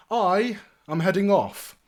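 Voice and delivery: high, loud and grand; lordly announcement. Acted. Young lord announces he is leaving, grand and cheery. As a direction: announce it with a flourish.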